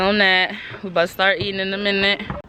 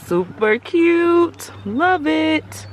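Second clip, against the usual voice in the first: gushing; 8 semitones higher, much slower than usual. Real, not acted. Admiring the car.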